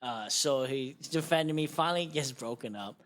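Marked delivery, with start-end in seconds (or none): none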